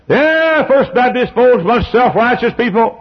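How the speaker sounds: high, loud sneering voice; mock scornful gossip. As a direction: sneer like a smug critic. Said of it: "mocking the doubters".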